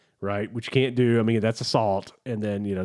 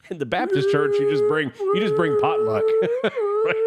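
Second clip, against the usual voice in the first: high, squealing laugh through the words; 5 dB louder than usual. Real, not acted. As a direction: crack up while telling the joke. Laughing through own church joke.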